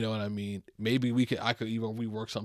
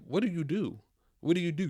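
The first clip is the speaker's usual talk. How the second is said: mock boss cutting in; 6 semitones higher than usual. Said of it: "cut me off and said".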